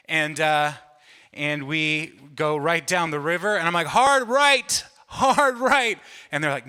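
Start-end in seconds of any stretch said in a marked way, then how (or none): 3.9-6.0 high, loud urgent shout; yelled rafting commands. Retold or imitated. Youth pastor yelling at the front rowers to turn. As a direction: yell it as the rock comes at you. Steering raft toward rock.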